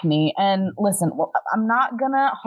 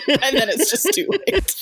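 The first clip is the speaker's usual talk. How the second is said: laughing; 6 semitones higher than usual. Real, not acted. Laughing while speaking.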